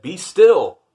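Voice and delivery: loud, high shout of command; yelled. Acted. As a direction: yell it like a boy king taming monsters. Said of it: "That means he yelled it".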